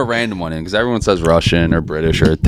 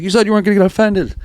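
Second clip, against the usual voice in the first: high whiny voice; 12 semitones higher, faster than usual. Retold or imitated. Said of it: "he was like".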